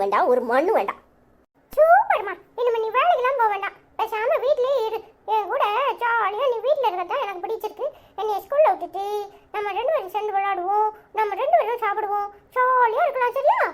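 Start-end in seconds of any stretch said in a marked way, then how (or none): 1.7-13.6 put-on much higher childish voice, cheeky and gleeful. Child gleefully telling mother how grandma panics, high kid voice. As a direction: play a little kid gloating over grandma.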